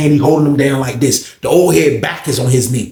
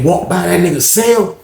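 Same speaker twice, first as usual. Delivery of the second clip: ordinary talk, nothing marked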